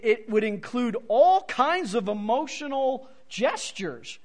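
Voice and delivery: ordinary delivery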